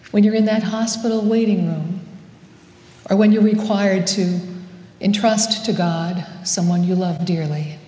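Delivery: normal talking voice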